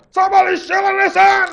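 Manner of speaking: high, loud shouted alarm; guard's alarm. Acted. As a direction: raise the alarm at the thief. Guards spot the thief.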